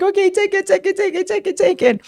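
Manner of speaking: high, panicked pleading; mock terrified giving in; terrified surrender. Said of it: "They're like".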